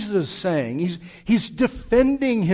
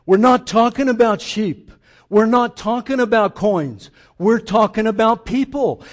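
louder, pressing each line; emphatic; 4 semitones higher, 7 dB louder than usual. Real, not acted. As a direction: drive the point home with force. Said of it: "preacher emphatic".

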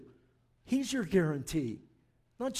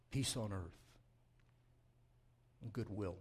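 closing prayer; 5 semitones lower, 5 dB quieter than usual. Acted. Pastor leads the church in a soft, hushed prayer. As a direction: lower and softer, fading to a hush.

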